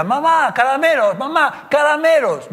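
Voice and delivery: put-on high, loud pleading child's whine; rising child's nagging whine. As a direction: beg like a kid at the end of his patience. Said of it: "cada vez creciendo la intensidad".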